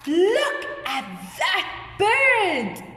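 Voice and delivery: high, slow, swooping exclamation; teacher demonstrating surprise; amazed. Shown as a demonstration. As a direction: point it out in drawn-out wonder. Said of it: "I'm amazed, I'm surprised".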